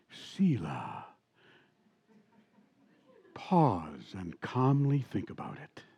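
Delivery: low, slow, smooth late-night radio voice; imitating a mellow radio host signing off. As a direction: ease it out like a midnight DJ. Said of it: "and he would talk like this".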